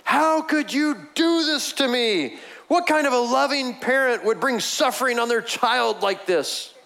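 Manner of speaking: put-on angry child's accusation. Child with skinned knee blames his father, mock angry whine. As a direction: throw the blame at dad, wounded and sulky. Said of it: "turns in anger to the father and says".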